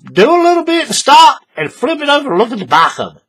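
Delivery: high, loud nagging reminder; emphatic instruction. Retold or imitated. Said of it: "I tell you all the time".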